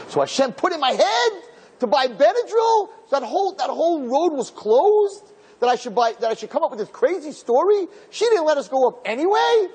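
high-pitched, amazed retelling. Real, not acted. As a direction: marvel at how it all worked out. Marvels at divine providence.

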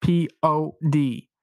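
P O D letter by letter, slow; spelled out slowly. Shown as a demonstration. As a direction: spell each letter deliberately. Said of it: "That's code pod".

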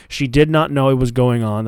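ordinary talk

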